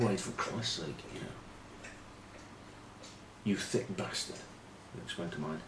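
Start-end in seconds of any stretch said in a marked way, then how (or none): 0.0-4.4 hushed telling-off. Retold or imitated. Retold telling off teammate quietly.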